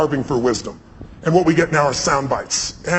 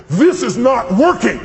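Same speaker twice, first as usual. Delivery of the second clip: frustrated Reagan; 6 semitones higher, 5 dB louder, slower than usual. Retold or imitated. Reagan stands up mid-fight.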